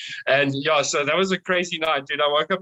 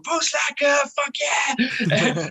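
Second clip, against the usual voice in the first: whooping party yell; excited shout; 10 semitones higher, much slower than usual. Retold or imitated. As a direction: belt out the slogan like a rowdy bandmate. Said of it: "was, like, saying it all the time".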